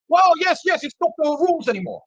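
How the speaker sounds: high-pitched mimicry of the enforcer; mock defensive reply. Mask enforcer excusing himself, high mocking imitation.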